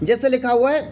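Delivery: normal talking voice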